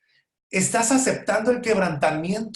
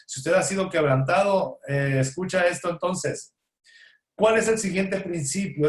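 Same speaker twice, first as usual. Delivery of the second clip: normal talking voice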